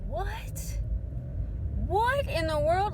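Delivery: high-pitched, rising shock; stunned disbelief. Retold or imitated. Daughter reads mother's text about grandmother, stunned disbelief. Reacts to news of grandmother's death.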